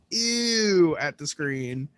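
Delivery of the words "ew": higher, mildly raised mimicked yell; retold yell. Host quoting Haley at the screen, mild mock yell. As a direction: imitate a disgusted yell, only slightly louder. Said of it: "you yelled".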